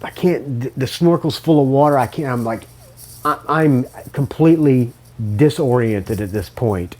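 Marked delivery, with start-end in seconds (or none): none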